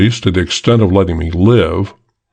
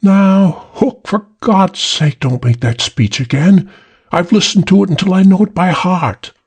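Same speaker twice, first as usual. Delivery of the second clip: put-on much higher, weary young woman; high, exasperated girl's voice; young woman's voice; 10 semitones higher than usual. A narrator's reading. Young woman scolding Hook, high and weary. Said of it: "The young feminine voice".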